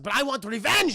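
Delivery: hammed-up vengeful yell; angry demand. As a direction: act out an upset brother demanding revenge. Carl upset, demands revenge.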